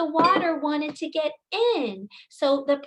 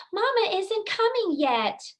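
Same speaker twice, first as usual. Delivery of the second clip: put-on fretting baby llama; 8 semitones higher than usual. Baby llama frets in story.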